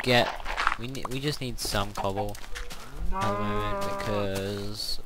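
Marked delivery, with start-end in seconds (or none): 3.1-5.0 yawning. Real, not acted. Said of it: "Sorry if I yawn too much".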